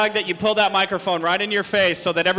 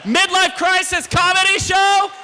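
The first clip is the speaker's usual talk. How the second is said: loud announcer hype; hype shout; 11 semitones higher, 4 dB louder than usual. Real, not acted. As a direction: pump up the crowd. Host hypes crowd at show open.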